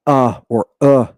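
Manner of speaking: "uh" as a brief vowel, in two versions; short upsilon. Shown as a demonstration. Teacher showing students the short version, brief "uh". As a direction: cut each "uh" short. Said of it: "it has a short ve-, version".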